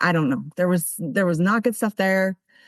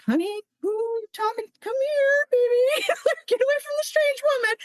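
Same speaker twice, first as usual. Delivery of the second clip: much higher, mock-mother voice; wary mom. Mom pulling her little girl away from a stranger, high mock-mother voice. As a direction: play the nervous mom, pitched way up with a laugh. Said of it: "even her mom was kinda like".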